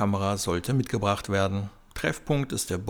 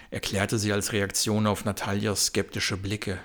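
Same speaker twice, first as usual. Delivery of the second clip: ordinary delivery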